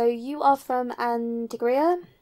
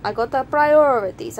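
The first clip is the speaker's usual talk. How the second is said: put-on border inspector slipping into Italian accent; put-on Italian accent, animated refusal; accidental Italian accent; 3 semitones higher, 4 dB louder than usual. Inspector turns away an entrant with expired papers, hammed-up Italian accent. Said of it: "I don't know why I got Italian".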